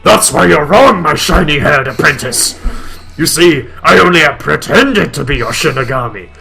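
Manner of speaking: loud, high booming villain reveal; shouting. Acted. Blood gloating to Dark, loud and high. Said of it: "Why you stop shouting?".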